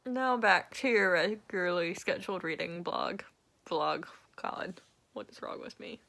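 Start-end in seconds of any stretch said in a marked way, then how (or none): 4.4-6.0 soft whispered self-scold; whispered aside. Real, not acted. Vlogger muttering at her own slip, whispered. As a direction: mutter it to yourself, embarrassed.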